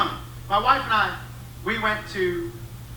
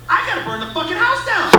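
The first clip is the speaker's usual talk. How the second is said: high-pitched ranting punchline; exaggerated, pitched-up outburst; comic outrage; 9 semitones higher than usual. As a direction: blurt it out in mock horror.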